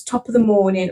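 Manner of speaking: light stock Irish greeting; mock Irish accent. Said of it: "Irish is".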